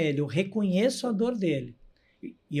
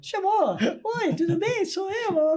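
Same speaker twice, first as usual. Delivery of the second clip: much higher, sing-song clown greeting; bright clown hello, pitched way up; 13 semitones higher than usual. Retold or imitated. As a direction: chirp it like a cheerful clown.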